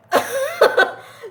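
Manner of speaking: a burst of real high laughter; breaking into a high giggle. Real, not acted. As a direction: laugh out at how absurd it was. Laughing at own story.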